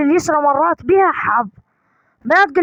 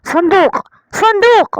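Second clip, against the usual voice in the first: higher, louder excited cry of صندوق; 6 semitones higher, 4 dB louder than usual. Real, not acted. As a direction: call out صندوق with rising excitement. Excited finding chest.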